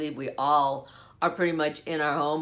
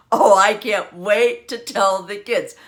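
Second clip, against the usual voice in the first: gleeful teasing; 8 dB louder than usual. Retold or imitated.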